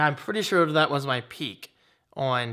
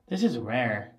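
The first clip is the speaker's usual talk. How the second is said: ordinary talk, nothing marked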